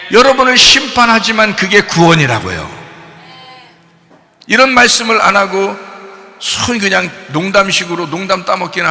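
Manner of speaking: loud, pressing pulpit exhortation; forceful preaching. Real, not acted. Preacher urging congregation about salvation, forceful preaching.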